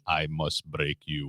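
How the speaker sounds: put-on slow, low, flat Russian accent; Drago-style Russian accent, slow and low; Drago impression. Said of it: "Rocky Four".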